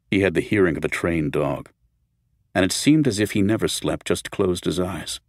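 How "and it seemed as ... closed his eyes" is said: plain reading voice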